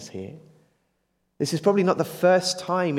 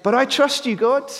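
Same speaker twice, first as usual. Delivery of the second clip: higher and louder, emphatic; emphatic declaration; 10 semitones higher, 5 dB louder than usual. Retold or imitated. Preacher voicing David's trust, emphatic and loud. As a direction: declare it boldly. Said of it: "It's very emphatic".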